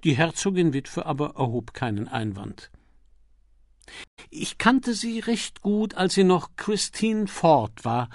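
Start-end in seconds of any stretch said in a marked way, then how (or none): 4.3-8.1 put-on high, slow elderly-mother voice; lightly voiced dowager. A narrator's reading. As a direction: speak slowly, pitched up as the duchess. Dowager duchess, Peter's mother, speaks. (hohe, langsame Herzoginnenstimme)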